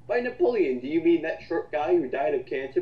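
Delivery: normal talking voice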